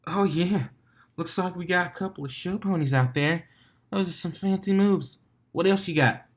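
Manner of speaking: mild announcer hype; lightly voiced DJ. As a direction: hype the crowd like a DJ. Vinyl DJ over speakers.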